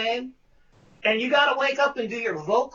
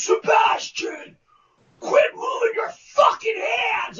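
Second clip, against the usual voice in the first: much higher, furious yell; yelling director; 11 semitones higher than usual. Retold or imitated. Director yelling at the actor during rehearsal, furious. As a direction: bark it at the actor from the stalls. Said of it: "So the director goes".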